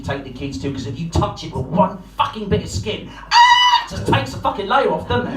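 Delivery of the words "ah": high, loud sudden yelp of pain; sharp ouch cry. Retold or imitated. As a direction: yelp as your skin tears off. Skin ripped off slide.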